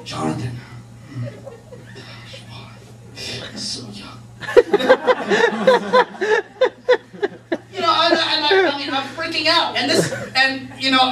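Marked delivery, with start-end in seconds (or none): 0.0-4.2 hushed, breathy mock pity; distraught whisper. Doctor mourns young Jonathan in a mock-tragic whisper. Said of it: "he looked distraught as he's saying it". 4.4-7.7 real high burst of laughter. Real, not acted. Laughing at own bit.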